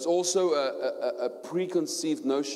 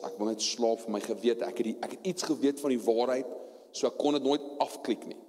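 normal talking voice

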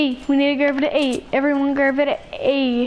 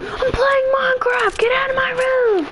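high-pitched whiny shout at mom; yelling gamer kid; 10 semitones higher than usual. Acted. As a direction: act out a kid yelling from the bedroom. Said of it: "And I'm like".

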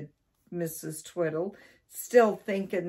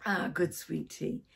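plain reading voice

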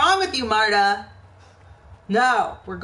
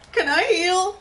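panicked; 10 semitones higher, faster than usual. Real, not acted. Panicking, can't find heal.

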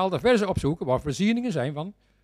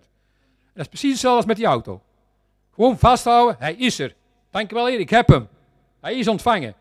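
loud, emphatic preaching with raised pitch; 6 semitones higher, 8 dB louder than usual. Real, not acted. Preacher assuring believers the car is coming, emphatic preaching. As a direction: drive each short phrase home to the congregation.

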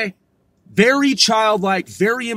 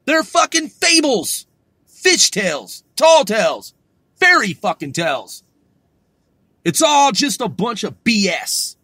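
higher, louder furious tirade; angry rant; 4 semitones higher, 4 dB louder than usual. Acted. Ranting at liberal media, a furious shouted tirade. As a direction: blow up, spitting out every insult.

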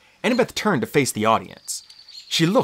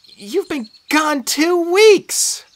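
put-on high, slow, loud girl's cry; hurt friend near tears; high, loud, slow reproach; upset Annabeth; 5 dB louder, much slower than usual. A narrator's reading. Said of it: "Annabeth's voice was steadier".

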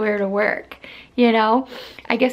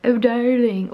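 put-on British accent; slower than usual. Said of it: "she has ... this accent, she always says".

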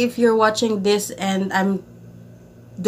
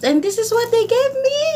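much higher, thrilled announcement; high excited gift reveal; 14 semitones higher, 4 dB louder than usual. Real, not acted. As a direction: show it off with a squeal of joy.

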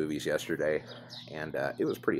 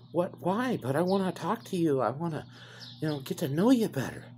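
much higher, put-on pleading scammer voice; mocking the scammer; 12 semitones higher than usual. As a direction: whine like a clingy fake lover.

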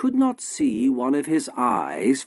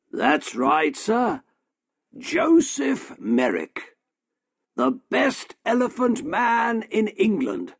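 higher, proud showman's sales pitch; boastful shopkeeper; 11 semitones higher than usual. A narrator's reading. Shopkeeper boasts to the doctor about his elephant man, proud. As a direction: sell the freak show to the doctor.

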